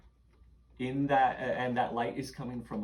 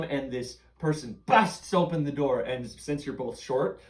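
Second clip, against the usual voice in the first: ordinary talk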